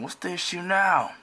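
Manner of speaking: high, loud irritated question; acted exasperation. Acting out exasperated Alexia.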